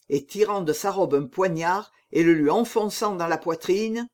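usual speaking voice

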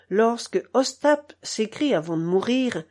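usual speaking voice

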